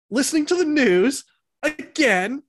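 aunt and uncle's outraged question; outraged relative. A narrator's reading. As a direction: snap it at the nephew.

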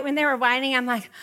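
ordinary talk

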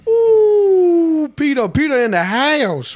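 high, loud hype greeting with Ooh stretched up; excited call-out. Retold or imitated. Barista greeting regular Peter at Starbucks, loud excited call-out.

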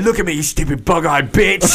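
hammed-up insult on the edge of laughter; Gordon Ramsay. Podcaster retelling the chef berating a cook, mock fury. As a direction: berate in a put-on voice, nearly cracking up. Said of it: "looked at me and said".

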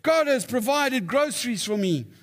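put-on high-pitched delighted old lady; acted rejoicing. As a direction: exclaim it in mock jubilation.

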